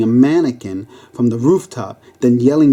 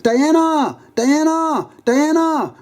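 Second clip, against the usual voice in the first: high-pitched repeated cry; wailing call for a name; screaming; slower than usual. Acted. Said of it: "she screamed".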